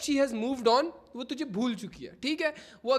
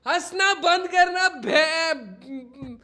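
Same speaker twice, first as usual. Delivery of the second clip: drawn-out pleading; 5 semitones higher, 6 dB louder, much slower than usual. Real, not acted. Begs chat to stop laughing.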